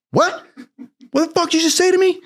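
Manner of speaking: very high-pitched mock disbelief; indignant squawked question; outraged chief. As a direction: imitate an incredulous superior, voice shot way up. Chief voiced, outraged at confession.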